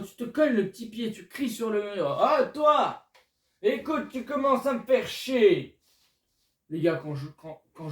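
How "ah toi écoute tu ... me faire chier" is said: high, loud mock rant in a Belgian accent; angry Belgian accent. Stubbing a toe, yelling at the wall in a Belgian accent. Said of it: "j'ai pris l'accent belge".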